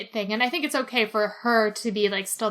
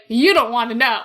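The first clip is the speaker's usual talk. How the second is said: louder, darkly knowing warning; imitating the doctor; 7 dB louder than usual. Retelling the doctor warning Milo off, lightly voiced and louder. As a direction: warn him off like a weary old medic. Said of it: "And Sweet's like".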